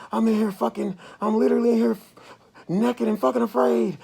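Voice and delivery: high, loud, frantic yell; panicked hollering. Retold or imitated. Man retelling a hospital panic, yelling high and loud. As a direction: shout it like you're scared out of your mind. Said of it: "I was literally in the room hollering".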